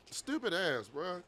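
normal talking voice

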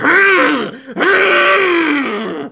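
menacing grunts. Acted. Menacing wife with trident.

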